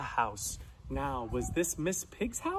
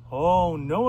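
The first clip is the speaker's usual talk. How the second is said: plain reading voice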